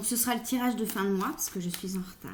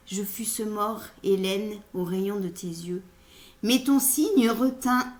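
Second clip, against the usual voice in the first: measured, projected poetry reading; poem recitation; 4 dB louder, slower than usual. Acted. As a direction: recite the sonnet with lifted line openings. Reads a Ronsard sonnet.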